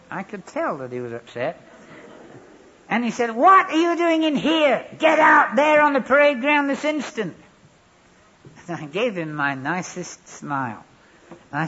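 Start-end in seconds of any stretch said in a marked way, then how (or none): 3.3-7.3 high, loud sergeant's yell; furious shout. Retold or imitated. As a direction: blast him off the parade ground. Angry sergeant bursts in.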